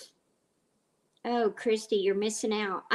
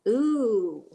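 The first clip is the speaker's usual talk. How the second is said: excited ooh; 3 semitones higher, 8 dB louder than usual. Real, not acted. Reacting to a viewer's comment.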